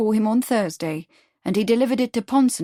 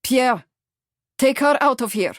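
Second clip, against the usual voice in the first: higher, urgent call; raised voice; 4 semitones higher, 4 dB louder than usual. A narrator's reading. Ivan ordering Pierre to take Lottie away, raised voice. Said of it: "He raised his voice".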